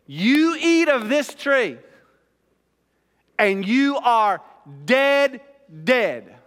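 loud, high, slow proclamation; emphatic warning. Acted. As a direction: thunder it out, pausing before each final blow. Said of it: "it's an incredibly emphatic statement".

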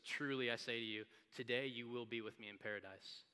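low, soft, gentle assurance; full of grace. Acted. Jesus answering the criminal, soft gracious promise. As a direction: promise it quietly and tenderly. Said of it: "it's full of grace".

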